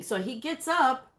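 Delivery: ordinary talk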